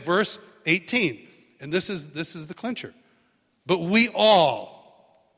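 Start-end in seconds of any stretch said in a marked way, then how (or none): 3.7-5.3 all stretched slow and loud; stress on all. Shown as a demonstration. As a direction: lean hard on "But we all". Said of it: "say all".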